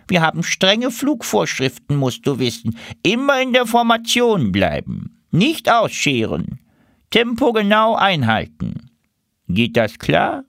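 high, commanding duck voice; strict duck leader. Acted. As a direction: rap out rules like a squad leader. Duck leader gives flight rules.

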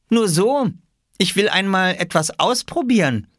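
put-on very high boy's voice; child character. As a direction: answer in a high, childlike pitch. Boy voiced in story dialogue.

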